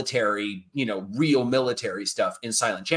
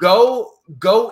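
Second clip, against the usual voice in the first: emphatic call; 8 semitones higher, 9 dB louder than usual. Real, not acted. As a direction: Go shouted, much higher and louder. Punchline of the podcast.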